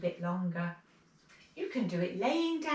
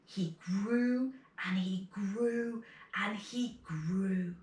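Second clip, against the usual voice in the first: slow stretched telling; slower than usual. Acted. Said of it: "stretched growing phrase".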